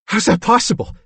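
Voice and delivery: high-pitched, incredulous question; astonished character voice; dumbfounded disbelief. A narrator's reading. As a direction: ask it in bewildered shock. Billy dumbfounded by news.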